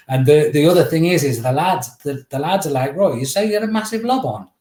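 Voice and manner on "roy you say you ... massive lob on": scoffing disbelief. Retold or imitated. Lads retold scoffing at Roy.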